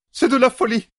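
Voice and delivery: loud, high-pitched outraged exclamation; crying out. A narrator's reading. An officer interrupts the assembly, crying out in protest. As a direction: burst out in protest, voice leaping up. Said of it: "s'écria Sheriam".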